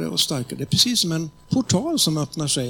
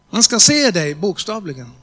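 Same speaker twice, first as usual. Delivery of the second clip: bold commanding prayer; 6 dB louder than usual. Shown as a demonstration. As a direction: pray it boldly, as a command. Leading a prayer aloud.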